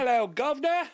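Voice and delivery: high, loud mock Cockney greeting; hammed-up Cockney hello; Cockney accent. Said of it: "some Cockney accent in there".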